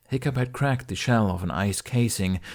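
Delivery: usual speaking voice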